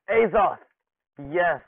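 loud, high cry of a name; thrilled yell, falling at the end; excited shout. Real, not acted. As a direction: shout the favourite character's name in delight. Excited at favourite character.

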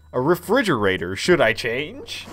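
mock-dramatic prompt read; reading the game prompt. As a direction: ham up the game's question.

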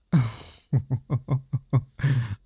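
long, delighted teasing laugh; amused laugh. Acted. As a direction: laugh fondly at her disbelief. Demon laughs at her What.

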